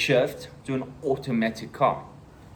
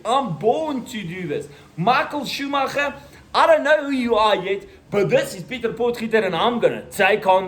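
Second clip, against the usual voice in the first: high, louder hammed-up champion boast; mock racer bravado; 6 semitones higher, 4 dB louder than usual. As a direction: brag like a race car hero. Bragging like racing champion.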